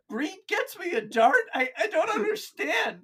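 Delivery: high, baffled orc squeak; confused orc. Retold or imitated. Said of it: "And he goes".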